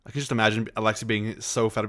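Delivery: ordinary talk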